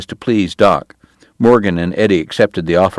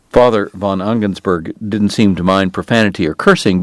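usual speaking voice

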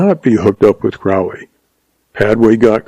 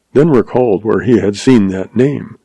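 plain reading voice

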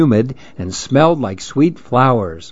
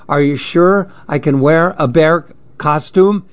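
usual speaking voice

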